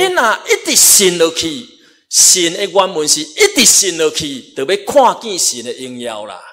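high, loud preacher's exhortation; forceful preaching. Real, not acted. Preaches with raised voice.

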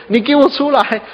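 angry echo. Retold or imitated. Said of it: "啊，山谷里面".

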